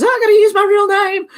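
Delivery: high, loud panicked wail; mock panic. Streamer mimics everyone panicking over real names, mock panic. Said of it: "before everyone panics and goes".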